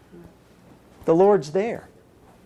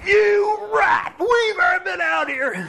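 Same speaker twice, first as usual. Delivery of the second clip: high, hammed-up furious shout; mock angry disciple; 11 semitones higher, slower than usual. Disciple shouting at God after a fruitless night, hammed-up. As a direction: yell at God like a fed-up fisherman. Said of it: "standing out on that ship going".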